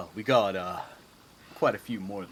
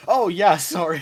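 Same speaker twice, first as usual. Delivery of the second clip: loud, high-pitched pretend sorry; mock apology; 7 semitones higher, 12 dB louder than usual. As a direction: blurt a breezy, play-acted apology. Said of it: "can we be like".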